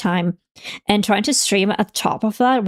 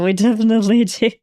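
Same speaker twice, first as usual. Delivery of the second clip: laughing through it, a bit faster; faster than usual. Real, not acted. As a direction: repeat it with a laugh breaking in. Repeats line, second take laughing.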